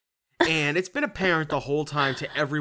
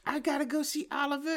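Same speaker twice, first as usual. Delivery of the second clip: much higher, softer voice; mocking Emily; 13 semitones higher, 4 dB quieter, faster than usual. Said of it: "she's like".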